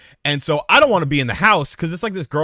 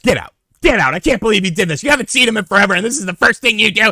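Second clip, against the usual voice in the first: higher, louder furious mother; angry mom; 9 semitones higher, 5 dB louder, faster than usual. Retold or imitated. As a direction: scold him like an outraged mom. Angry mom throwing him out.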